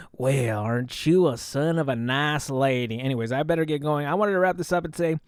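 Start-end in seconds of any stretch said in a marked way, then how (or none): 0.1-3.0 cowboy impression with a drawl; drawling cowboy character voice; Bussy Hill. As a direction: play it as a hammy cowboy. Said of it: "doing my Bussy Hill impression".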